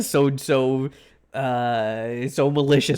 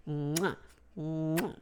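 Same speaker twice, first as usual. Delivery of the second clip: soft air kiss; 13 dB quieter than usual. Real, not acted. Blowing kisses to chat.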